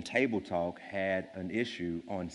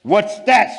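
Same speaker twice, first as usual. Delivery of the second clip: snorted; 11 semitones higher, 15 dB louder than usual. Acted. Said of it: "snorted Yertle".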